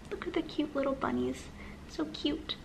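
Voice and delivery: plain reading voice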